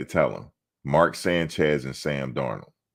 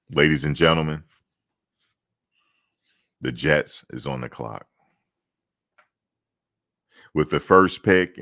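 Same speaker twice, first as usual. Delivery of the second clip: louder formal stage announcement; draft announcer; 4 dB louder than usual. Pretend. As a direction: address the crowd like a league announcer.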